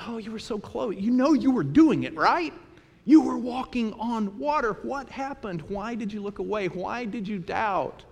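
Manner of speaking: Jesus tenderly asking Peter why he doubted; gentle disappointment. Acted. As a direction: coax him kindly, like a fond teacher.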